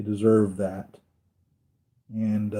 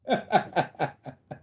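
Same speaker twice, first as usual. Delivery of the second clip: amused laugh; 6 semitones higher, 5 dB quieter than usual. Real, not acted. Amused by the movie line.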